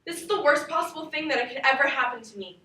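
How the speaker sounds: put-on a teenage girl pleading with her parents as they split up; hurt daughter begging then blowing up; upset daughter. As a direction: play the child hearing her parents divorce, from disbelief to anger. Acted monologue of divorce scene.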